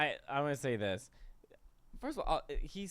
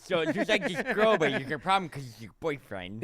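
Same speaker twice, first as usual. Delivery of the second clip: mocking the letter writer. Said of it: "can I say what he really sounds like".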